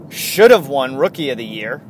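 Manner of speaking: normal talking voice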